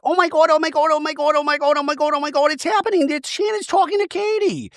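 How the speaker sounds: high, breathless oh my God; mock gasping gossip. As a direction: squeal it like gossip breaking. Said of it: "Gina sees this and she's like".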